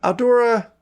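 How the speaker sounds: high, sing-song call. Real, not acted. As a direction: call the player by name. GM calling on player.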